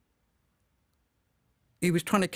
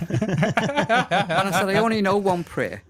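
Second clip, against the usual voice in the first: laughing; 2 semitones higher, 3 dB louder, slower than usual. Real, not acted. Storyteller laughing at his own story.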